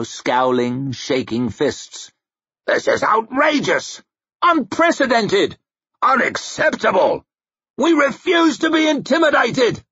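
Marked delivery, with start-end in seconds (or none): 2.7-9.8 high-pitched indignant shouts; scandalised lords shouting objections; outraged protest. A narrator's reading. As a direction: cry out in defiance. Many lords protesting on their feet.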